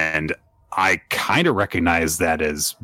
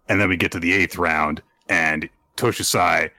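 normal talking voice

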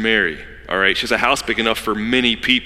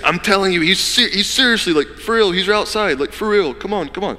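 insisting; 7 semitones higher than usual. Retold or imitated. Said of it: "She kept insisting".

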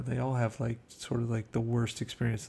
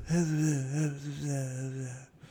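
imitated higher-pitched gibberish mumble; mock muttered gibberish; 4 semitones higher than usual. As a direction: mumble nonsense, imitating a foreign language. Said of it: "He mutters some".

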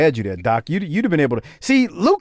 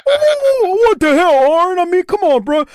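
very high, flustered put-on voice; mock outrage, squawked high and stammering; indignant guest impression; 12 semitones higher, 3 dB louder than usual. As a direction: mimic him protesting way up high. Mimics guest's indignant outburst.

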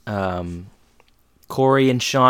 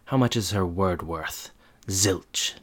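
understated menace; cold low dismissal; 4 semitones lower, 7 dB quieter, slower than usual. Retold or imitated. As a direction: lower and much softer. Manager coldly dismisses her word.

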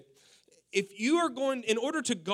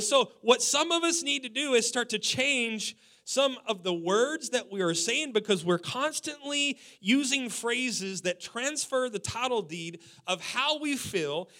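ordinary delivery

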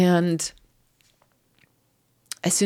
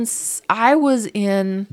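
normal talking voice

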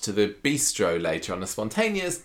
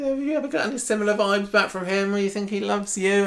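much higher, anxious probing voice; worried Daisy; 13 semitones higher than usual. Retold or imitated. Daisy quizzing Jenny about Leo, high and anxious. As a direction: fret out the question like a worried friend.

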